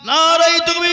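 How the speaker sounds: loud, high rallying cry; forceful slogan shout. Real, not acted. Preacher leading the crowd in the takbir, forceful shout. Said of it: "জোর দিয়ে বলি তো বাপ".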